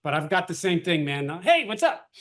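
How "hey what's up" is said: much higher, bright DJ hey; drive time radio DJ. Retold or imitated. Trainer mocking his own radio DJ style phone greeting. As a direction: hype it up like morning radio. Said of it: "Sounds like I'm on drive time radio".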